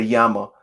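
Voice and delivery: llama with the double L as a Y, yah-ma; Spanish llama. Shown as a demonstration. Correcting herself after chat says to say llama the Spanish way. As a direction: say llama as yama, Spanish style. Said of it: "The two L's are, are a Y".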